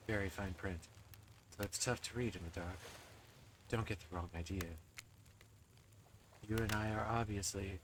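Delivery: low, soft, standoffish killer; aloof spirit voice. Acted. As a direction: murmur it, pretending not to care. Spirit character voice.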